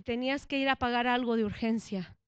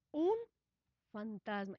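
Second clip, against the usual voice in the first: softer and lower, barely saying it; hushed, stunned murmur; 4 semitones lower, 8 dB quieter than usual. Acted. Disciples in the boat, frightened, murmuring quietly. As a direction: disciples murmuring a ghost under their breath. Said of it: "se turbaron".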